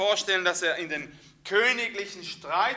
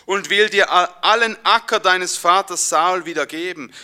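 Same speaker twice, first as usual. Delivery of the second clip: louder, solemn Bible reading; scripture reading; 9 dB louder than usual. Acted. Preacher reading the verse to his church, solemn and louder. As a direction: proclaim the verse to the congregation.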